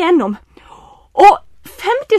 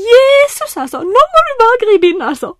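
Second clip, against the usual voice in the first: desperate plea; 9 semitones higher, 6 dB louder than usual. Retold or imitated. Desperate prayer in retold crisis.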